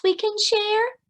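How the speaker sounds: lightly voiced, high, friendly badger; a hint of a cheerful badger; Badger with a grin. A narrator's reading. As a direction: offer the nuts with a grin. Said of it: "Badger says with a grin".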